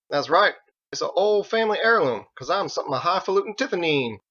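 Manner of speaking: high, folksy cowboy. A narrator's reading. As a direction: play the proud rancher. Biggs character voice.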